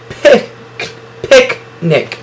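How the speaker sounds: "Pic-" called out high, then "picnic" blended; syllable by syllable. Shown as a demonstration. Teacher sounding out syllables before reading the word. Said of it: "two short I sounds".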